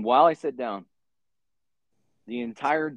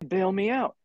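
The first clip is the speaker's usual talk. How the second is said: cellmate; 12 semitones higher than usual. Retold or imitated. Cellmate voiced begging bail.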